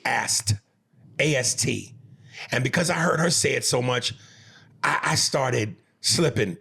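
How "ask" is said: "ast" said with a final t in place of "ask". Retold or imitated. Repeating how his baby says ask, as "ast". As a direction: say "ast" the way she does. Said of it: "she would always say".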